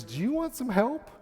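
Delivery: lightly voiced helpful pilot; concerned pilot. Pilot offering a lost passenger help, lightly imitated concern. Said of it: "And he goes".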